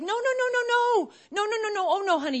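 high, loud string of panicked no's; alarmed mother. Acted. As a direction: rush to stop a child in danger. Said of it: "And the mother's like".

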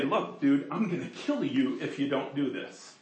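low, hushed menace from God; quiet threat. Pretend. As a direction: murmur the threat softly, close and serious. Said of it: "Do you understand what I'm saying?".

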